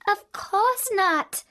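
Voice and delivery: put-on high, slow girl's voice; a little girl's slow, wide-eyed answer; Dorothy answering. A narrator's reading. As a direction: innocent child's reply. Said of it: "answered Dorothy".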